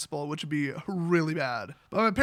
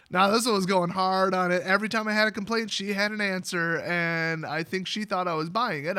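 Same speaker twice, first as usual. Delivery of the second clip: normal talking voice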